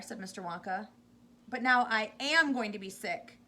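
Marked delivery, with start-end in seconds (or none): 1.5-3.4 loud queasy yell. A narrator's reading. Missus T V about to be sick, loud yell. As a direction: yell that it's coming now. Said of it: "yelled Missus T V".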